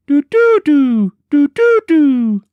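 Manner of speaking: high, loud piping bird cry; bird call. A narrator's reading. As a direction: pipe it out like a bird. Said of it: "And then again the same".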